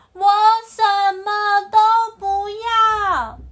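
loud, high, slow tearful wail; anguished crying shout. Acted. The antelope begging the lion for mother back, crying. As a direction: cry it out broken-hearted, word by word.

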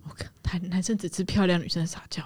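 unvoiced whispered aside; whispering. Real, not acted. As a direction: whisper it as a secret.

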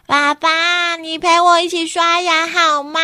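put-on high, pleading child; little kid voice. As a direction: ask like a small child. Laixi asks dad to brush teeth.

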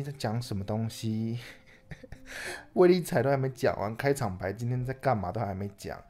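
ordinary talk, nothing marked

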